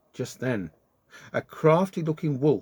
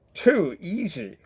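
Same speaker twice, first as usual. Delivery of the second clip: gloating wolf; 6 semitones higher, slower than usual. Acted.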